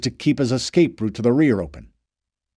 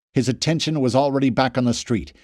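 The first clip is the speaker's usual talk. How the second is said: plain reading voice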